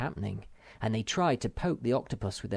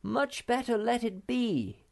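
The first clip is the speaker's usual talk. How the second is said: high, cautioning call; pitched-up voice, urging restraint; 13 semitones higher than usual. A narrator's reading. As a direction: call it over as a warning. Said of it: "called Snufkin".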